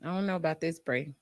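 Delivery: soft, doubtful murmur; quietly unsure, speaking to her friend. Retold or imitated. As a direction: hold back your unease. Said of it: "I'm like".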